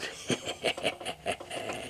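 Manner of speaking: breathy, hushed evil chuckle; soft giant chuckle. A narrator's reading. Giant laughs.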